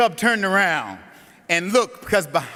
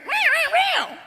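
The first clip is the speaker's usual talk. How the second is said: string of tiny high mews broken by laughter; cub's meows; slower than usual. Acted. Preacher voicing the cub's repeated meows at the wolf, high squeaky cries through laughter. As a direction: squeak meow meow meow, cracking up. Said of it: "He went".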